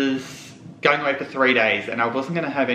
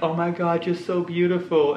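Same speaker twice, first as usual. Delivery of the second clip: lightly voiced gushing customs lady, pitched higher; higher-pitched mock sympathy; 6 semitones higher than usual. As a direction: imitate a stranger cooing a compliment. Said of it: "the customs lady's like".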